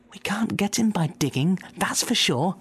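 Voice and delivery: high, hushed scheming farmer; a farmer conspiring, high and hushed; fat farmer Boggis. Acted. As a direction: whisper it like a plotting farmer. Boggis voiced in story.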